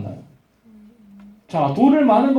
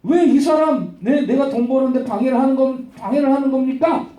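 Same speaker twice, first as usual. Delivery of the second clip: raised-pitch, acted-out protest; indignant complaint; 3 semitones higher than usual. Owner reports Paul to the police, indignant complaint. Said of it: "경찰에다 신고했다".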